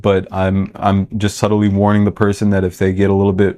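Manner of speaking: usual speaking voice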